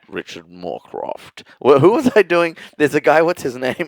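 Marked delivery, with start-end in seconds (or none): 0.1-1.4 low, soft, slow newsreader voice; Australian news presenter, hushed and slow; Richard Moorcroft. Shown as a demonstration. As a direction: drop low and draw the name out. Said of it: "There'd be like".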